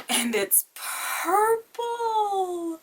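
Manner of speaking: very high, drawn-out cooing squeal; delighted squeal. Real, not acted. Unboxer sees the purple palette, squealing with adoration. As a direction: stretch the words out in high-pitched delight.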